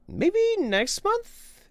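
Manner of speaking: high, slow sing-song tease; coy high question; teasing falsetto. Real, not acted. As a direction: float it up playfully. Teasing reply to viewer.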